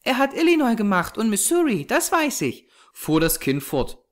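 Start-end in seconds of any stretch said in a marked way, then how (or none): 0.0-2.7 put-on very high child's voice; assured little girl. A narrator's reading. Small girl telling what God made, innocently certain. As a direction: declare it in a small girl's high, knowing voice.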